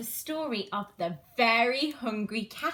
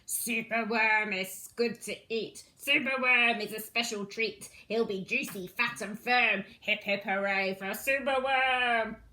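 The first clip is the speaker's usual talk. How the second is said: dreadful croak. Acted. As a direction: croak it out like a greedy villain crow. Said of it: "listen to his dreadful croak".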